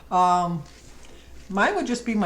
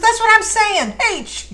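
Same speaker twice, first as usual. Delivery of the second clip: mock angry shout, much higher and louder; imitating an irate father yelling haitch; furious retort; 11 dB louder, slower than usual. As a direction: snap back at the operator, exasperated. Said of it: "And he'd get so mad".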